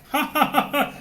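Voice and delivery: high, loud fake laugh; mock laughter. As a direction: ham up mindless TV laughing.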